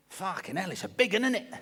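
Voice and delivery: impressed father-in-law. Acted. Father-in-law holding a thrashing spider, high amazed voice. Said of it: "my father-in-law says this to me".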